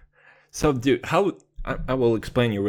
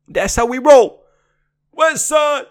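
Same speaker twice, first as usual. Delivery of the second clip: even higher, louder hype yell; louder gangster shout; 13 semitones higher, 10 dB louder than usual. Acted. Mock gangster rapper yelling West Side. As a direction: crank the hype all the way up.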